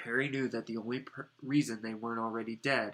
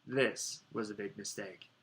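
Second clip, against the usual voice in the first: ordinary delivery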